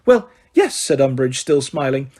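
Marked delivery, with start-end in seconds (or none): 0.0-0.8 high, sugary correction; simpering. Acted. Umbridge correcting McGonagall, simpering. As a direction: simper the name.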